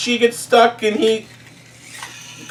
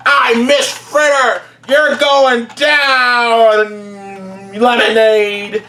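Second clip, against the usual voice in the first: a hint of a menacing bus, higher and slow; bully school bus; 3 semitones higher, 3 dB louder, much slower than usual. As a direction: drag out the threat like a tough bully. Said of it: "she's like".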